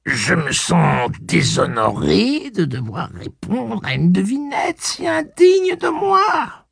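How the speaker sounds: high, slow wounded whine; whiny spoiled pouty drawl. A narrator's reading. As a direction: sulk like an offended child. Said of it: "fit Blaine d'un ton boudeur".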